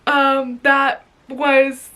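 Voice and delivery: ordinary delivery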